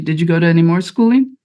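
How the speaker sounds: usual speaking voice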